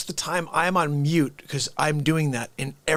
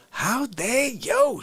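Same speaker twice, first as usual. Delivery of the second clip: slow mock how dare you, pitched up; drawn-out catchphrase; 6 semitones higher, much slower than usual. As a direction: stretch the catchphrase out. Mock catchphrase after reading title.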